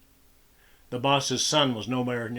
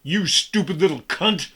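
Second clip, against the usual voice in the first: louder, harsh furious scream; enraged scream; 6 semitones higher, 7 dB louder than usual. A narrator's reading. Father screaming at his daughter in rage, furious scream. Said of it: "he screamed like an enraged animal".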